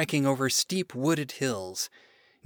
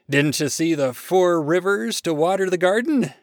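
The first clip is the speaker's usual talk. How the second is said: louder, boastful God; proud God; 4 semitones higher, 6 dB louder than usual. Acted. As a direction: brag about your rivers. God boasts of his rivers.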